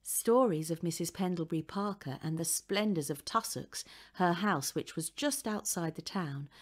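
usual speaking voice

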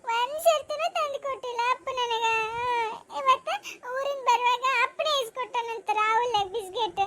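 put-on high, whining child voice; tearful child's complaint. Child complains to mother that father never brings biscuits, high tearful whine. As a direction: whine with hurt, pitched high throughout.

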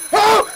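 sudden high, loud yelp; startled scream. Real, not acted. Player caught by a scare mid-apology, startled high scream. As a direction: cry out as if jumped.

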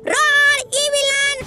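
high, carrying call to the enemies; playful high-pitched call. Real, not acted. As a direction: sing out their names to taunt them. Calling out to game enemies.

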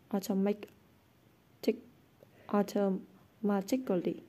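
ordinary delivery, nothing marked

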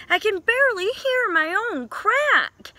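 shouting. Acted. Duck strains to be heard over the waves, high and loud. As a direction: shout to be heard above the surf.

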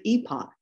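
EPOCH said the British way, as EE-pock; British-style EE-pock for EPOCH; British pronunciation. Shown as a demonstration. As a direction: say EPOCH like a Brit, long EE first. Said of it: "saying it like a Brit".

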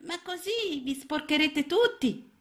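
put-on very high, small child-frog voice; a tiny lost frog, unsure; little frog. A narrator's reading. Lost little frog answering Mrs Balzelli, high and small.

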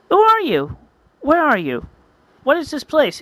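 high, startled questioning; bewildered hatchling. Acted. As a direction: fire off high, confused questions. Lonely hatchling asks unseen voice.

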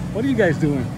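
ordinary talk, nothing marked

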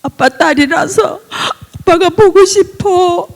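slow, high, crying voice; tearful gratitude. Real, not acted. Daughter thanks her father, slow and tearful. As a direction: thank him through sobs.

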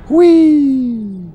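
put-on high, loud playful whee like a showing-off child; hammed-up childish glee; gleeful kid. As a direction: call out to mom, mock delighted. Gleeful calling while jumping.

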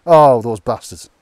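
loud, slow and disgusted; outraged exclamation. Real, not acted. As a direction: let it out slowly, genuinely appalled. Finds campsite spoiled by litter.

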